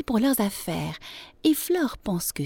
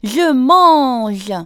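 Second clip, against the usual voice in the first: loud, higher-pitched protest; exclaiming; 6 semitones higher, 10 dB louder than usual. A narrator's reading. Young hamster answers his scolding mother, loud exclamation. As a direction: cry out in protest. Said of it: "s'exclame Léonard Dichon".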